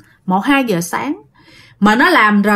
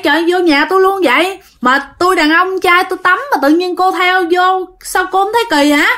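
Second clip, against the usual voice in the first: higher, fast flustered protest at a strange woman; exasperated scolding; 6 semitones higher than usual. Retold or imitated. Dad scolding the ghost girl who followed him, exasperated and higher. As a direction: retell dad's indignant complaint, pitched up and hurried. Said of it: "ba nói rằng".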